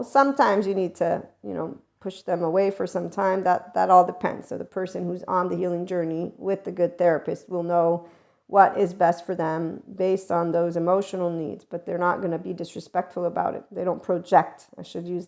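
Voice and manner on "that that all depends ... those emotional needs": normal talking voice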